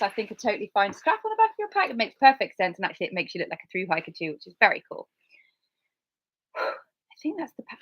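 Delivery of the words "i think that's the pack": sighing whisper. Real, not acted. Sighs, wrapping up.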